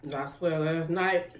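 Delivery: normal talking voice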